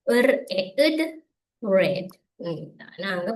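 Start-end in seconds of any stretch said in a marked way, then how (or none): 0.1-2.1 "இர், எ, இட்" apart, then joined "ரெட்"; phonics blending. Shown as a demonstration. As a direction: sound out each piece, then blend "ரெட்". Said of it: "இதுல என்ன செய்யணும் பாருங்க".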